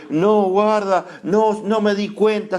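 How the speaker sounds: high-pitched protesting excuse; mock excuse-maker. Preacher imitating someone making excuses, high mock protest. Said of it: "Porque alguno dice".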